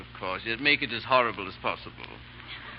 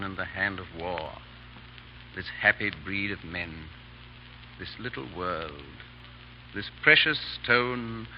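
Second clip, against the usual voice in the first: poem recital; 3 semitones lower, 6 dB quieter than usual. Acted. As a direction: savour each line of the poem softly. Recites Shakespeare to Chauvelin.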